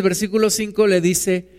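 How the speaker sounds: ordinary talk, nothing marked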